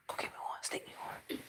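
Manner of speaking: unvoiced whisper asking secretly. Real, not acted. Asking the child to sneak him a cookie, whispering. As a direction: whisper so granny won't hear.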